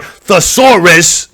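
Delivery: thesaurus spelled out slowly syllable by syllable; slow, deliberate thesaurus after stumbling on it; careful pronunciation. Shown as a demonstration. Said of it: "I can't even say that word".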